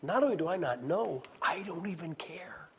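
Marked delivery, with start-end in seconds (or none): 1.4-2.7 hushed, almost whispered, tossed off; whispered aside. Acted. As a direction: drop it to a sly whisper.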